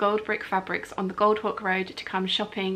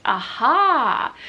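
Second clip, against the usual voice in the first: loud knowing aha; playful "aha"; 3 semitones higher, 6 dB louder than usual. Real, not acted. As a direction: pounce on the joke. Reading the winner's comment.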